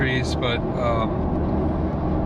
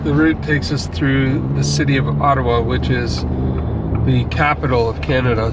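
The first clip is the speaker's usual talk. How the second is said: travel vlog narration; 5 dB louder than usual. Acted.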